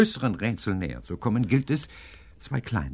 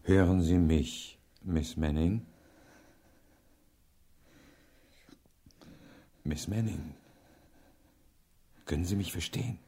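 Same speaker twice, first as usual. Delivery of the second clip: softer, gentle questioning; soft bedside voice; 3 semitones lower, 5 dB quieter than usual. Acted. Professor questions a weak patient, gently. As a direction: speak softly to the sick woman.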